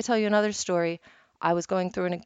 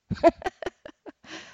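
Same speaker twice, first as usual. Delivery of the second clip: delighted laugh; 10 semitones higher than usual. Real, not acted. Delighted by teacher's reply.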